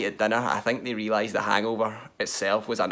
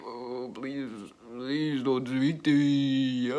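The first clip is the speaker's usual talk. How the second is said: much softer, slow, weak groaning plea; hungover plea; 6 dB quieter, much slower than usual. Retold or imitated. Hungover me begging schoolmates not to talk, weak soft plea. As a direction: beg for silence like a sick hangover.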